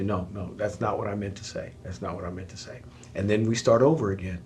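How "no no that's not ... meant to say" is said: much softer, low and gentle; soft apology. Retold or imitated. Spouse retracting hurtful words, soft and low. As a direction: take it back quietly.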